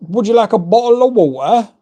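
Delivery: put-on light parody of a kid's quip; imitating daughter. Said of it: "as my daughter says".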